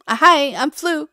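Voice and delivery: a light mock channel intro, "Flu" said brighter; test-drive the new name as if greeting viewers; trying out a new intro. Shown as a demonstration. Vlogger tries introducing herself to viewers by new name, lightly performed. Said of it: "Does that even sound right?".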